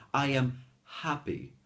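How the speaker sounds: HAPPY begun with a light puff of breath; gentle exhaled H on HAPPY; soft dog-pant H. Shown as a demonstration. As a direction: model "happy" with breath only, no rasp. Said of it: "That is the sound of the H".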